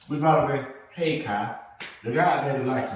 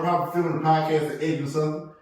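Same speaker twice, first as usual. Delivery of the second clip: normal talking voice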